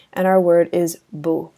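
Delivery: usual speaking voice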